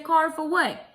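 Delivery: high, loud indignant question; incredulous retort. Retold or imitated. Acting out her alarmed reply to caller.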